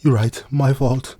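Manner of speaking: drawn-out sheepish concession; a lightly voiced swordswoman; Sheta's voice. Sheta's slow reply.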